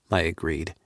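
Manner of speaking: ordinary delivery, nothing marked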